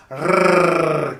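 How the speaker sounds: a loud, held tongue trill; rolled R. Shown as a demonstration. Teacher to children, demonstrating a loud rolled R. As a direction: trill the R hard and long. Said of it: "So I'm rolling my R's".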